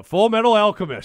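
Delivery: loud, high joking callout; excited exclamation. Real, not acted. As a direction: announce it with a grin. Jokey nickname called out loudly.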